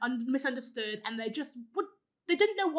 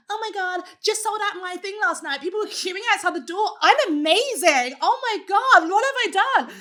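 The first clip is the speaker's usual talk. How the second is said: high, loud, gushing put-on voice to take the piss; hammed-up show-off crowing about a sold-out night; high loud mocking brag voice; mock bragging influencer; 7 semitones higher, 7 dB louder than usual. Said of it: "not in that accent, obviously. I don't know what that was".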